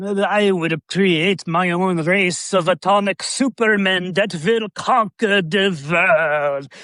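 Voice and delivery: slow, grand mad-scientist declaration; over-the-top slow Lugosi monologue; scenery-chewing villain. Retold or imitated. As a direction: savor every word like a ham. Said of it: "just eating scenery".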